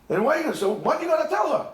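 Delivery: ordinary talk, nothing marked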